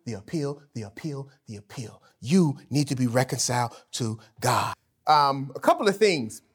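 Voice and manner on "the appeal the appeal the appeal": softer and lower with each "the appeal"; hushed, dropping echo of "the appeal"; fading repetition. Real, not acted. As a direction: let "the appeal" sink away. Repeated point fading away.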